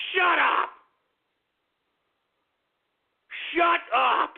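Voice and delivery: sharp yelled shut up; angry shout. Real, not acted. Host yelling at fans mocking him online, angry shout. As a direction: cut them off hard. Host snapping at Twitter jokers.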